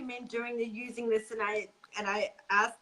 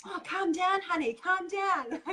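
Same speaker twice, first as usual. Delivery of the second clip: patronizing man; 8 semitones higher than usual. Retold or imitated. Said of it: "Let's calm her down".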